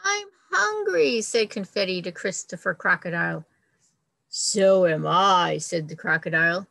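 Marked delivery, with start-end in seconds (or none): 0.0-1.2 high, louder whiny plea; hungry baby bird. Acted. Confetti begging the giraffe, high whiny plea. 4.3-5.6 slow, louder menacing crocodile; hungry crocodile. Acted. Crocodile menacing the little bird, slow and loud.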